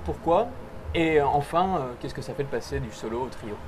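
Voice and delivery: usual speaking voice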